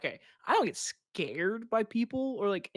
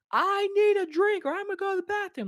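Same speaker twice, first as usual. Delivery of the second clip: high, raised club voice; shouting over music; 13 semitones higher, 5 dB louder than usual. Acted. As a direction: call out over a blasting sound system. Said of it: "the loudest trap music ever".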